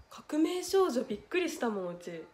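usual speaking voice

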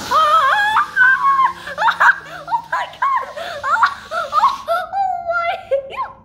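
very high, breathless laughing exclamation; laughing shriek. Real, not acted. Child reacting to the giant balloon, shrieking with laughter. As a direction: break up laughing as you cry out.

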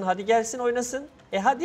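mounting, rising challenge; goading challenge. Real, not acted. Gamer dares everyone to come and play, goading. Playful challenge to other players.

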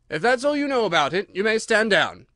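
high, loud royal dismissal; dismissive king. A narrator's reading. King sends the useless witness down, loud and commanding.

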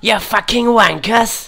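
loud, slow, high-pitched British yell; British accent. Pretend. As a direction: bellow it like an angry English lout. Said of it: "I can do a British accent".

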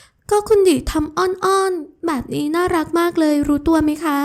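high, slow, playful voice; teasing sweet girl. Acted. Ramon teases Adisa for acting cute, high and playful. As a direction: tease her fondly, voice lifted.

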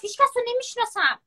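high, mocking imitation of the ex; mocking quote. Mocking her ex-boyfriend's excuse to viewers, in a high sneering voice. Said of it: "چقدر دروغ میگه".

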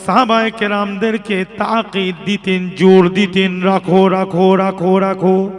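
loud, sing-song sermon chant with drawn-out phrase endings; ওয়াজের সুর. Real, not acted. Chanted sermon retelling the command. (সুরেলা উচ্চকণ্ঠে ওয়াজ)

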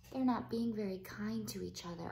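ordinary delivery

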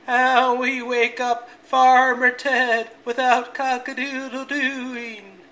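high, loud, slow bleating voice; sheep voice. A narrator's reading. Sheep asking rooster, high bleating voice. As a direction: bleat it out slowly.

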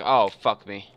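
ordinary talk

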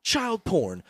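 Tucker Carlson. Acted. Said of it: "Tucker Carlson".

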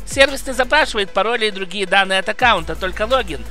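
brisk upbeat sponsor read. Acted. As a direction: sell it like a quick promo. (рекламная подача)

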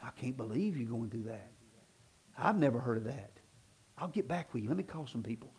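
mock hushed sympathy. Pastor mocking a dismissive caller, hushed and low. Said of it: "never gonna hear me go".

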